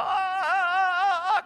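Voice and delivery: long, high, loud cries of joy; drawn-out cheering cries; joyful shout. Retold or imitated. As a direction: shout out like a rejoicing crowd. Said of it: "como hacen los judíos".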